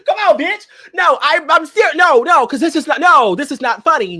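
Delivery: high, loud insistent protest. Real, not acted. Host insisting to giggling friends, loud and high. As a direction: shout down the laughing.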